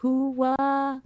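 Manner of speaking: timid, weak hooah; a limp try at the Pacino shout; half-hearted Pacino hooah. Pretend. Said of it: "Can you do that?".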